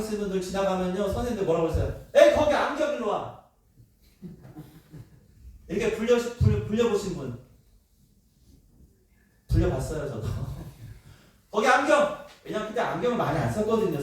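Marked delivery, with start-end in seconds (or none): 2.1-3.4 high, loud call across the classroom; teacher calling out. Retold or imitated. Teacher summoning a kid in glasses, loud call. 11.5-12.3 high, loud call across the classroom; teacher calling out. Retold or imitated. Teacher summoning a kid in glasses, loud call.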